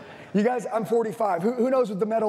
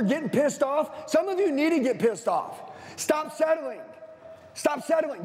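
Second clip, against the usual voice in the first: fired-up higher preacher voice, pushing the crowd; rally them hard, pitch up and drive it; fiery motivational sermon; preaching at full force; 5 semitones higher than usual. Acted.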